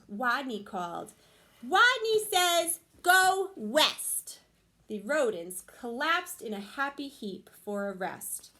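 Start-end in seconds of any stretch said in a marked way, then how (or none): 1.7-4.4 high, loud, slow command; strong voice. A narrator's reading. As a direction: boom it like a hero. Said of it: "In a voice so strong".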